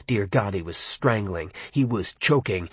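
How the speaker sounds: ordinary delivery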